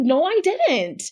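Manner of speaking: high, drawn-out no I didn't; slow denial. Retold or imitated. Wife denying to her husband, high and slow. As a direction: stretch the denial. She denies.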